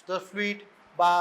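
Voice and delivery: normal talking voice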